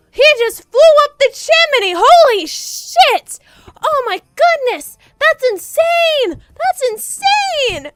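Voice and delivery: loud, high shocked yell; shocked outburst. Real, not acted. Streamer reacting to Santa flying up the chimney, astonished yell. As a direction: blurt it out in astonishment.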